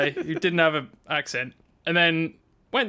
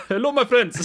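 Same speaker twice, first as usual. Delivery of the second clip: higher, louder mock South African accent; hammed-up South African impression; 7 semitones higher, 5 dB louder than usual. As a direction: imitate a friend's absurd South African greeting. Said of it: "came back, sounded ridiculous".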